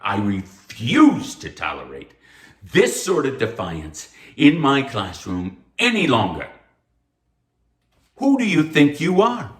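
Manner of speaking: furious professor rebuking defiant student, building to a shout; angry outburst. Acted. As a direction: tear into the student. Professor's tirade peaks.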